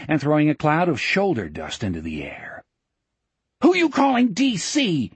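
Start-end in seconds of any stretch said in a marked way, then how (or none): 3.6-5.1 high-pitched furious screech; shrill, offended screaming, pitched way up; outraged scream. A narrator's reading. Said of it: "he screamed".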